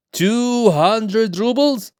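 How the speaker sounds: high, slow, drawn-out disbelief; high stretched-out shock at the price; astonished. Acted. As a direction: let the sum stun you. Governor surprised by the sum.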